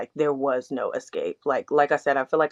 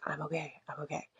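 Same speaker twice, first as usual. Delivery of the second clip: much softer, murmured to herself; soft self-reassurance; 14 dB quieter than usual. Retold or imitated. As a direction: whisper the comfort to yourself, half-believing it. Telling herself she's fine.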